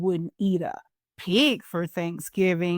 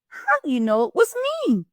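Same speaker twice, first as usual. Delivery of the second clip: fast, higher, whiny moan; Turkey moans, caught out again; 7 semitones higher, much faster than usual. Acted. Turkey to Cow, fast higher whiny moan. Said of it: "moaned Turkey".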